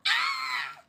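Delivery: put-on shrill girlish scream; high squeal. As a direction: let out a high startled squeal. Chifu squeals.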